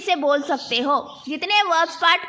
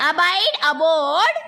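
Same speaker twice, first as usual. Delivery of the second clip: slow, drawn-out teacher chant; measured classroom verb recitation; repeat-after-me drill; much slower than usual. Shown as a demonstration. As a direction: stretch each word for the class to echo. Leads word repetition drill.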